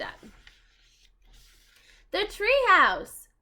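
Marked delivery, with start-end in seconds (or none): 2.1-3.3 high, loud, slow joyful cry; excited. Acted. The lost children spot their treehouse, high loud joy.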